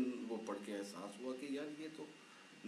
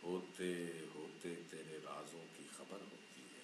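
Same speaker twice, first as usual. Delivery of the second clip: poem recitation; 3 semitones lower than usual. Acted. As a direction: recite the couplet tenderly. Poet recites next couplet.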